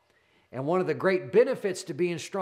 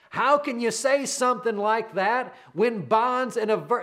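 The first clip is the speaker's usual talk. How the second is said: ordinary talk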